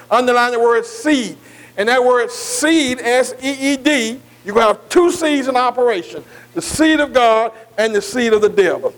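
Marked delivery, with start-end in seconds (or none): none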